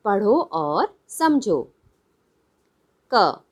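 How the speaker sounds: ordinary talk, nothing marked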